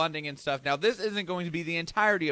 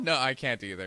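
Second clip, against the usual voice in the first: ordinary talk, nothing marked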